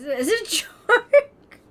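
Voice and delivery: real laugh swallowing the last word; breaking into laughter. Real, not acted. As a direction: crack up before you reach the end. Cracking up mid-sentence while streaming.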